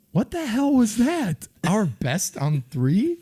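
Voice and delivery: high, slow, disbelieving; mock the weird chant in disbelief; incredulous. Players baffled by odd chant.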